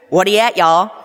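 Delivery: mock Texan accent. Daughter obliges dad with Texan for strangers, mock Texan accent. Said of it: "say something in Texan to these people".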